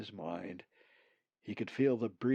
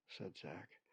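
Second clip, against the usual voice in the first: ordinary delivery, nothing marked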